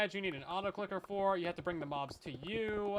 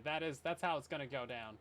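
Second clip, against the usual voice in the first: ordinary talk, nothing marked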